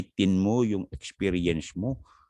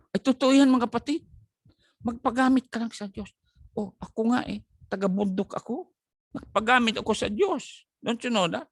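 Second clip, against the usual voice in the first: fired-up preaching. Acted. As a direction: rouse the congregation. Preaches at full force.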